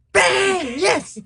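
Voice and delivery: high cheering shout; excited cheer. Retold or imitated. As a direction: cheer the shot going in.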